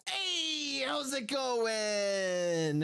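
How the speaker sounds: very high, stretched holler; long, cheery high call; high drawn-out greeting. Acted. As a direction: greet them high, sliding slowly down. Player greets the bards back.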